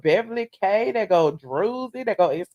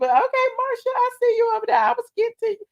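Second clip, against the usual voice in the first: much higher, sing-song reassurance; playful teasing; 12 semitones higher, faster than usual. Real, not acted. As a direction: tease Marsha that she's next. Teasing a viewer directly.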